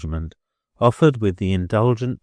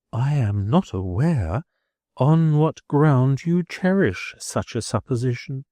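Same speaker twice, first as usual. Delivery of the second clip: prim schoolmistress; 5 semitones higher than usual. A narrator's reading. Miss Twinkleton replies, dignified.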